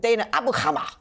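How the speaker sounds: hammed-up Arabic pronunciation of the name; BBC-style Arabic accent. Shown as a demonstration. Redoing the student's name for laughs, in a mock Arabic accent. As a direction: overdo the Arabic accent like a newsreader. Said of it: "let me do that in my B B C accent".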